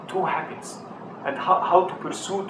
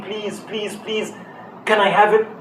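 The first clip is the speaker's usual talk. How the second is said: mock childlike pleading, a little higher; begging child; 4 semitones higher, slower than usual. Father imitating his child begging for a gift, mild pleading. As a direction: plead like an eager kid. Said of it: "and to be begging".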